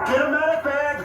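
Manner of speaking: man down shout. Real, not acted. Officers shouting for medic at scene.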